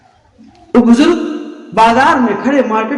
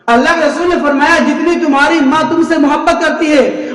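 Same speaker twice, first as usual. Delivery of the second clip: raised, insistent sermon pitch; impassioned preaching; 5 semitones higher than usual. Real, not acted. Preacher urging congregation to repent before God, impassioned preaching. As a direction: preach in long, ringing high phrases.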